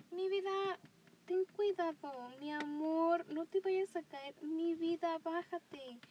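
high, loud sing-song mom voice; doting mom. Retold or imitated. Mom fussing at her little one, high and doting. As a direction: fuss over a child who might fall.

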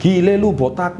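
mocking a bald head with a jeering shout; tactless mock praise. Shown as a demonstration. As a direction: blurt it out like a cheeky friend. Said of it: "Anda jangan puji".

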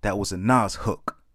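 punchy spoken-word poem, lines thrown out loud; comic slam poem read to the crowd; poem performance. Acted. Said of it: "I call it mutants".

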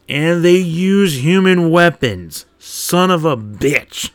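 loud, drawn-out exasperation; mock outrage. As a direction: stretch out each word in comic grievance. Mock outrage at enemy ambush.

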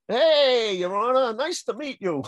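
butter up the judge; mock schmoozing.

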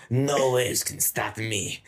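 hammed-up Spanish movie hero; Antonio Banderas. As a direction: draw it out like a Spanish action star. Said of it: "that's the Banderas".

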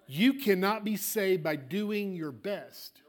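ordinary talk, nothing marked

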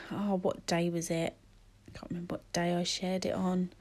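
very soft, half-whispered thinking aloud; muttering to self. Real, not acted. Host trying to remember what day she shared it, muttering to herself. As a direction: trail into a quiet mumble while trying to remember.

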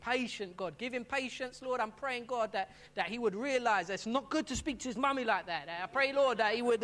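loud preachy prayer aimed at the son, not at God; pointed mock prayer. Mother mocking a preachy prayer said loud so her son overhears. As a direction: pray it so the boy overhears. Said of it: "I pray this over my son".

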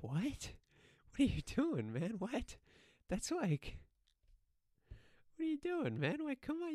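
high-pitched baffled disbelief; exasperated squeaky disbelief; incredulous. Acted. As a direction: react in mock bewilderment, voice jumping up. Mock reaction to absurd threat.

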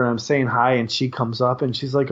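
usual speaking voice

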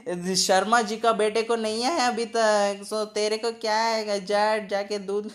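fast mocking nagging parent. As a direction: rattle it off like a scolding mom.

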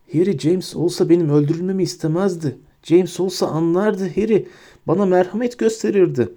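high, wheedling rat-man voice; cringing Pettigrew plea. A narrator's reading. Pettigrew grovels to Hermione, high wheedling plea.

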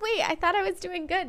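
shocked protest; surprised. Retold or imitated. Hearing husband say her voice goes up, surprised.

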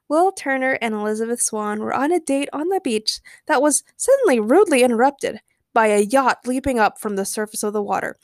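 ordinary delivery

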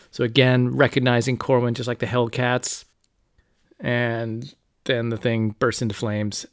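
usual speaking voice

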